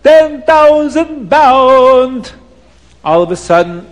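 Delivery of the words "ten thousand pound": put-on high, loud, very slow accented bidding cry; Abdallah screaming. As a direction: scream out the auction call. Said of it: "Abdallah is screaming".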